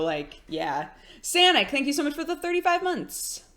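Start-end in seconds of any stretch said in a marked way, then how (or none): 1.2-3.4 high, sing-song thank-you voice; high put-on voice. Real, not acted. Streamer thanking a subscriber, high sweet voice. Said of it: "Is that the voice you'll speak to your child in?".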